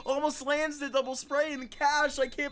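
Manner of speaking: high, rising hype call; excited yelling. Real, not acted. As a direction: shout the clutch in disbelief. Clutch play, caster erupts.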